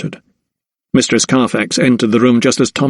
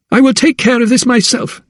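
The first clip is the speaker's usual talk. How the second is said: much higher, furious declaration; enraged cry; 12 semitones higher than usual. A narrator's reading. Wife refusing her husband's help, furious. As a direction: cry it out, incandescent with rage. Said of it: "she cried".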